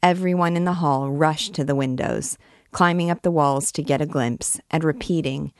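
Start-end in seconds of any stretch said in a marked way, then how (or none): none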